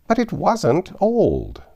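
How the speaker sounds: high, slow furious wail; in a fury. Acted. Tweedledum rages at Alice over his rattle, high and slow. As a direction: drag out the protest in a rage. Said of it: "cried in a great, greater fury".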